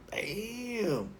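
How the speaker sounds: drawn-out, high, soft damn; impressed husband's long soft exclamation; mock amazement. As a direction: stretch it out in admiring disbelief. Impressed husband watching wife dance.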